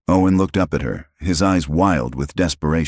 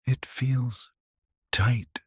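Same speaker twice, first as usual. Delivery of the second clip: put-on weak child whisper; 8 semitones higher, 7 dB quieter, much slower than usual. A narrator's reading. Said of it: "she whispered".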